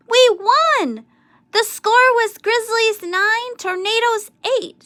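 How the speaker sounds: high, loud, slowed victory news; joyful announcement. A narrator's reading. Jimmy telling Amelia Bedelia the winning score, high and loud.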